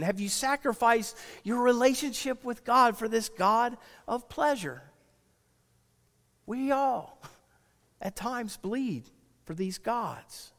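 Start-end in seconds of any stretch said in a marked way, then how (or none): 6.4-10.5 much softer, slow confession; preacher softly admits a shared failing; solemn hush. Acted. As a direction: let it land quietly.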